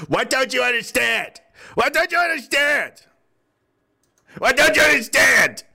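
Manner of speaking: high-pitched, exasperated outcry; mock exasperation. Mock exasperated repeated question to listeners.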